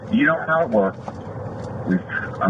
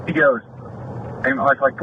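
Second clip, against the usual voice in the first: ordinary talk, nothing marked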